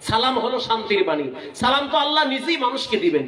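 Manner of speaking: ordinary talk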